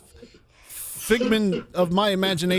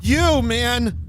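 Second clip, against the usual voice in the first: loud sudden yell; shout; 8 semitones higher, 5 dB louder than usual. Real, not acted. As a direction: yell it at the screen. Yelling at game.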